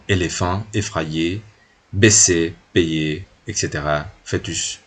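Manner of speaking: "éléphant", "effrayé", "baissé" con É cerrada francesa; pronunciación francesa. Shown as a demonstration. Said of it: "Entonces, bueno". (lista de palabras francesas con É)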